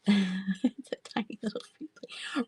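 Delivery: giggly whispered aside. Real, not acted. Seller showing the plate back, whispered. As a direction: whisper it through a laugh.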